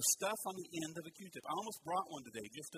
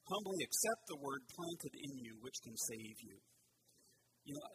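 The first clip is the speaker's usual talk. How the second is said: ordinary talk, nothing marked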